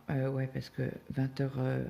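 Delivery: usual speaking voice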